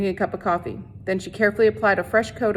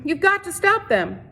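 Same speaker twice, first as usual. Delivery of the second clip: high, pressing plea; urgent plea; 10 semitones higher than usual. A narrator's reading. Catherine urging the sheriff to stop the mob, high and pressing. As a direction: insist he must act.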